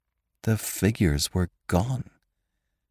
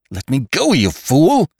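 high, loud desperate outburst; frantic gasp; 7 semitones higher, 5 dB louder than usual. A narrator's reading. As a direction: gasp it out, struggling to break free. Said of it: "I gasped".

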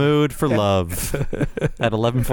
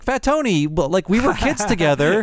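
put-on high, excited old-friend voice; Homer; 8 semitones higher than usual. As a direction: greet a childhood pal, pitched well up. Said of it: "when Homer meets Fat Tony, he should be like".

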